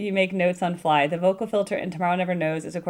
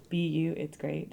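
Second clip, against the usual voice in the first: softer, read like a haiku line; quiet haiku reading; softer poetic take; 7 dB quieter than usual. Shown as a demonstration. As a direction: let it fade like verse. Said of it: "That's not a haiku".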